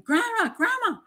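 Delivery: put-on very high child's call; lightly voiced little girl calling out; child calling grandma. Granddaughter calling for grandma, a high child's voice. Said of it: "my granddaughter's saying".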